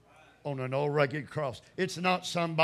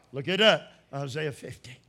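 ordinary talk, nothing marked

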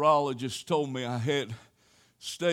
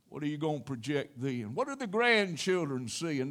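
usual speaking voice

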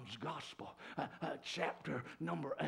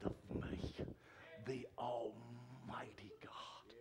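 soft, low, whispered testimony; hushed whispered preaching; 4 semitones lower, 8 dB quieter than usual. Acted. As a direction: draw them in with a hush.

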